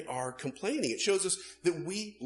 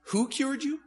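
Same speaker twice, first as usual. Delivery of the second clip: higher, eager question; lightly voiced Brian; curious Brian; 10 semitones higher, 3 dB louder than usual. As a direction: ask who did it. Brian asks ex-leper.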